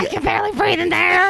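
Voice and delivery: high, breathless squirrel. Acted. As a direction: gasp it out as the squirrel. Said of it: "the squirrel voice is too good".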